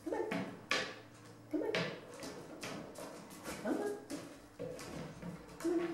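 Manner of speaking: usual speaking voice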